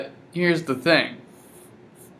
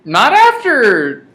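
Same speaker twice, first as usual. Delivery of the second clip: high, slow, loud mock drama; hammed-up reply; 9 semitones higher, 6 dB louder, slower than usual. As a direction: ham up the anguish.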